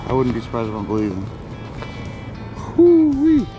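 ordinary talk, nothing marked

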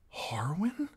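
unvoiced hesitant whisper. A narrator's reading. Girl recognizing her father's man, unsure whisper. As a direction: breathe the name in disbelief. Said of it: "Arya whispered".

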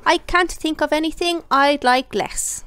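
contrary little miss. Acted. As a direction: fire back sweetly contrary.

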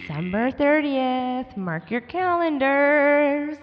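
slow, drawn-out promo read; announcer voice. Acted. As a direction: stretch every word like an ad. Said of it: "announcer voice".

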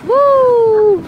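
loud high woo; excited whoop. Real, not acted. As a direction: cheer it out.

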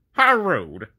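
snobbish raspy huff, high and loud; D199 voice. Shown as a demonstration. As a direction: play an affronted snob with a pinched nose. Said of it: "that's the voice I want D one nine nine to have".

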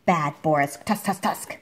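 usual speaking voice

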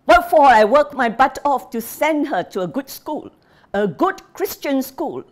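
put-on an old father shouting at his family. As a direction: bellow it after them from the other room. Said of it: "The old man's voice followed them, shouting".

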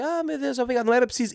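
voz aguda e melosa gozada; fingir-se comovida com as flores; imitação de rapariga. Retold or imitated. (rapariga encantada imitada em tom agudo)

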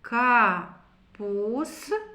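капуста stretched very slowly, sound by sound; drawn-out word. Shown as a demonstration. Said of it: "Прочитаємо". (повільно, протяжно, по звуках)

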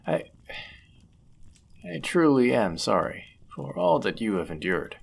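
usual speaking voice

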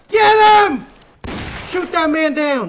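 high, loud call to attack; hammed-up command; mock rallying shout. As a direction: yell orders to a posse. Orders others to attack in game.

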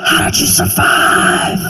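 high, gasping mock horror; shocked outsiders. Outsiders hearing I'm from South Carolina, mock horror. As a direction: ham up the pearl-clutching outsider. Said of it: "they're always like".

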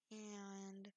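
ordinary talk, nothing marked

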